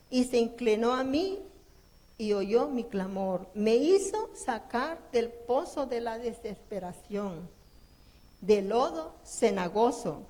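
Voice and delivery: slow, loud solemn reading of the psalm; preacher reading Psalm 40 to the church, slow and loud; scripture reading. Shown as a demonstration. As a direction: read it out like a proclamation.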